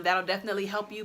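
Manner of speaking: normal talking voice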